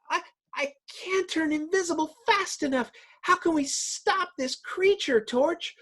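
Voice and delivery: high, fast worried heroine voice; Invisible Girl. Acted. Invisible Girl asking Torch for help, high and anxious. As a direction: squeak it out in a high panicked falsetto.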